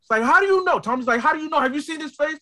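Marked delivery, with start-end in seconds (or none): 0.2-0.8 loud, high mock challenge; Tommy. Tommy to Officer Brackett, loudly challenging. 1.2-2.4 high, agitated challenge; Tommy. Retold or imitated. Tommy to Officer Brackett, high and challenging.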